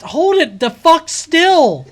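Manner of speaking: loud, slow angry yell; frustrated yell. Real, not acted. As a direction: yell it at the screen. Yelling at shaky camera.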